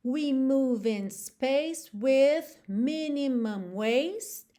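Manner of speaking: slow rhythmic delivery of the lyric; slow metred line. Shown as a demonstration. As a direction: chant the line in the song's metre. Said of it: "Já tô fazendo aqui na métrica".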